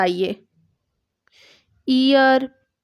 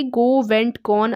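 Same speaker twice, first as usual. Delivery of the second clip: slow demo; much slower than usual. Shown as a demonstration. Teacher models verb forms.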